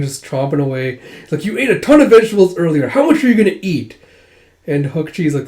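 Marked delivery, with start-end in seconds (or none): 1.4-4.0 high, loud, fast exasperation; exasperated teasing. Retold or imitated. Friend scolding the dragon's appetite, exasperated. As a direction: rattle it off in disbelief.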